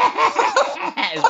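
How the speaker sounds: laughing out the words. Real, not acted. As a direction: laugh while you say it. Laughing through a gross joke.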